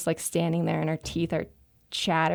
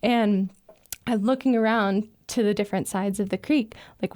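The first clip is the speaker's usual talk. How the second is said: ordinary talk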